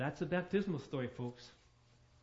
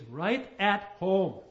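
right at home dragged out slowly and louder; slow emphasis; 2 semitones higher, 7 dB louder, much slower than usual. Acted. Preacher to congregation, pressing right at home slowly.

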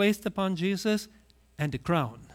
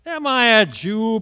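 loud, higher offended snap; indignant retort; 4 semitones higher, 9 dB louder than usual. Acted. Pilate answers Jesus, loud indignant retort. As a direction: throw the question back, insulted.

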